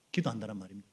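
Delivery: ordinary delivery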